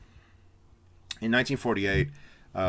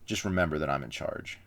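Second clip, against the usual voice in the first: lower, a touch of cold command; sudden switch to stern; 5 semitones lower, 3 dB quieter than usual. Retold or imitated. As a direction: drop the act and lay down the law. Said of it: "completely changes".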